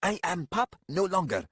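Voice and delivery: high-pitched snarling protest; indignant snarl. A narrator's reading. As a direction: bristle at being called a pup. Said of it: "Hear him snarl".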